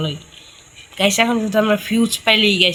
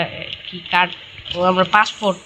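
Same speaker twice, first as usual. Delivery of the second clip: ordinary talk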